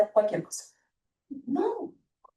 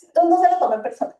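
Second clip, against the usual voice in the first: usual speaking voice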